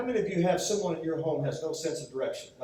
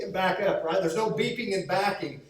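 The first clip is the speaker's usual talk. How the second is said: normal talking voice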